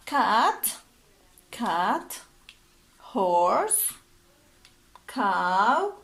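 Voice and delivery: each word called out loud and separate; loud drill words with pauses between; vocabulary drill. Shown as a demonstration. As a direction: model every word clearly for the class to echo. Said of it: "Please repeat".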